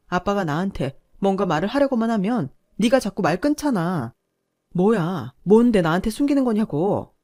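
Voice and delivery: loud, accusing rant that keeps building; wife confronting her husband about his secret, furious yell. Acted. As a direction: hurl every suspicion at him, shouting. Wife confronts husband, hidden truth.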